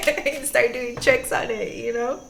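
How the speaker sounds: high burst of laughter into speech; laughing. Real, not acted. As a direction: giggle through the words. Laughs at own bike analogy.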